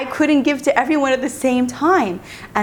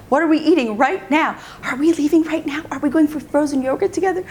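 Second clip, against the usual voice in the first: higher, insistent pile-up of questions; pestering kids, imitated; 6 semitones higher than usual. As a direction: badger mom with pitched-up demands. Children pester mother at once.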